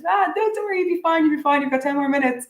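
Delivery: airy high breezy voice, waving off worry; playful high reassuring voice; mock carefree reassurance. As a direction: brush it off brightly, like a friend keeping you late. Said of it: "I'd be in the restaurant being like".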